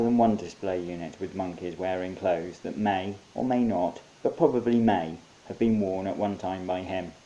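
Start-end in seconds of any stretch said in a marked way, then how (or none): none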